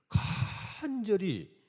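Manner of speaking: 간절히 stretched very slow, high and loud; 간절히 drawn out high and pleading; earnest pleading. Retold or imitated. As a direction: lean hard on 간절히 like a desperate plea. Describing the pastor's earnest plea.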